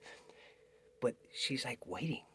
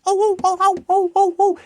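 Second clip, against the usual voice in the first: panicked cries; 14 dB louder than usual. Acted. Girl sees the wolves coming.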